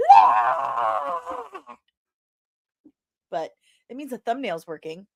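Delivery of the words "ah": imitating a teenage fan's shriek; teen self shrieking at meeting Buffy; fangirl scream. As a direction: squeal like a starstruck teen. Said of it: "sixteen-year-old me going".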